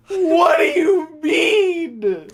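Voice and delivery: high, slow, half-sobbed with laughter; laughing cry. Real, not acted. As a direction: gasp it out through laughter. Still cracking up.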